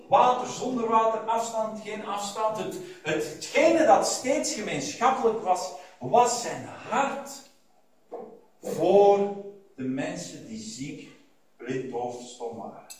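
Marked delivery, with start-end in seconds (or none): 6.0-12.9 slow, dropping to a hush; tender, slow. Acted. Preacher to congregation about Jesus' heart, slow and hushed. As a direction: linger on the heart, then fade.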